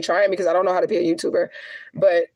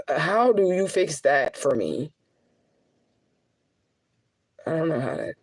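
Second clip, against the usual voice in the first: holding back tears. Real, not acted. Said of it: "I'm trying not to cry".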